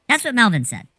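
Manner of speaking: usual speaking voice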